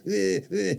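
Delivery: high whiny "eh, eh" in imitation; mocking baby noises. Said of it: "why you sound like baby".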